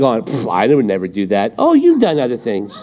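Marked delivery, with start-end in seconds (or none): 0.0-1.5 low, scoffing self-righteous denial; smug denial. Retold or imitated. Preacher mocking a listener's denial, low and scoffing. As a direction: scoff as if above it all. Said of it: "And you're like".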